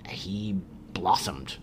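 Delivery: soft awed whisper. Real, not acted. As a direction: breathe it out in wonder. Awed whisper about father.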